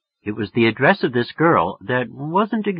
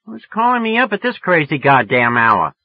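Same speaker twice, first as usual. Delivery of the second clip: louder, higher irritated demand; 6 semitones higher, 5 dB louder than usual. A narrator's reading. Woman scolding a late-night caller, louder and irritated. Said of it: "That sort of scared me".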